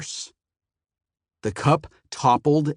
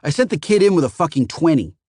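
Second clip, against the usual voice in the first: higher, louder complaint; angry accusation; 6 semitones higher, 5 dB louder, faster than usual. A narrator's reading. Phoenician accuses the clerks over his change, angry. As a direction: accuse him hotly. Customer accuses shortchanging kid.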